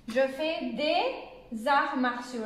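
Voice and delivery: drawn-out, careful model phrase; slow example sentence. Shown as a demonstration. French teacher gives the plural example, very slowly. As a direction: space the words out for beginners.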